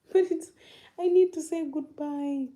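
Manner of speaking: a playful wail of not letting go; mock heartbreak. Streamer pretends to grieve her torn shirt, hammed-up and loud.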